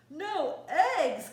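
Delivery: loud shocked outcry; cried out. Acted. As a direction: shout it in disbelief. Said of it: "cried Farmer Brown".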